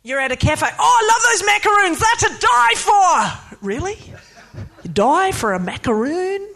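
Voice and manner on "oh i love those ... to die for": higher, mock-rapturous gush over a treat; gush, then draw out the last words; gushing café customer.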